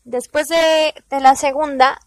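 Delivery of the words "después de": usual speaking voice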